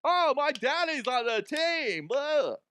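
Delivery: put-on sing-song like a spoiled child; high whiny bratty kid. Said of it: "Little snots running around".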